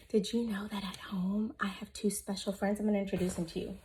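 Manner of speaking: soft secret whisper; whispered secret for the class. Real, not acted. Teacher shares a secret with kids.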